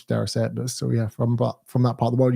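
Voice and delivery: normal talking voice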